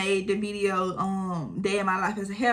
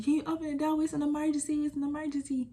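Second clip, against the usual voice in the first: higher, urgent plea through the door; pleading neighbour; 7 semitones higher, 5 dB quieter than usual. Retold or imitated. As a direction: beg like it's an emergency.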